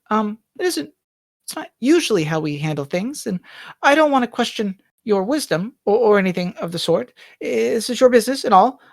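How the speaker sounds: a shy maid daring to question her employer; hesitant maid Bonnie. Acted. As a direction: ask the master nervously, almost apologising.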